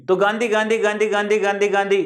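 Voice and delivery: गांधी repeated with no break, nasal आं; गांधी, smooth and nasal; smooth pronunciation. Shown as a demonstration. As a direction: flow गांधी without a stop. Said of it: "क्या रुकावट हो रही है?".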